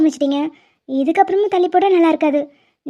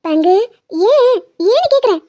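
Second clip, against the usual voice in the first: mock indignant; 3 semitones higher than usual.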